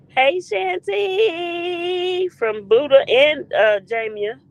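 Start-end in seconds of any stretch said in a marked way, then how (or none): 0.1-2.3 high, very slow singsong hello; lingering sing-out of the name; drawn-out greeting. Real, not acted. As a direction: stretch the name right out. Stretched greeting to viewer.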